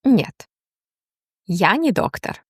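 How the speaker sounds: normal talking voice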